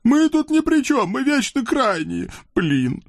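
very high squeaky devil voice, pleading; a scrawny devil begging off; whiny little devil. Acted. Наум ноет. (писклявый чёрт)

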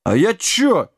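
high-pitched flustered giant; embarrassed. A narrator's reading. Giant challenged about drinking, embarrassed. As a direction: back off, caught out. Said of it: "смутился великан".

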